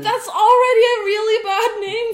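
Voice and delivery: high, fast, laughing complaint; laughing protest. Real, not acted. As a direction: protest while cracking up.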